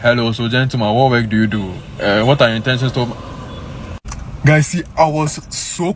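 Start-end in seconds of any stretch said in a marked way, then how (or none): 0.0-3.1 low, imitated funny African dad accent; mock the stern dad's accent, pitched down. Imitating the date's dad grilling him, in a low funny accent. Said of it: "in some very funny accent".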